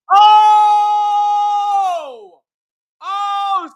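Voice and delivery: sudden high, loud cry of disbelief; a real shouted reaction to the score; excited shout. Real, not acted. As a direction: yell it out in shock at the result. Reacting to the Darnold result.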